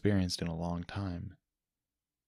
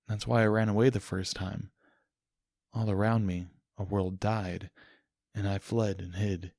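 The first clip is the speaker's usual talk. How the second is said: ordinary delivery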